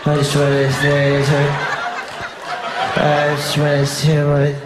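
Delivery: slow, slurred drawn-out chat-up voice; hammed-up, droning come-on; mock young straight fella. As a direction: drag every word out like a dopey suitor. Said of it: "They come up to 'em".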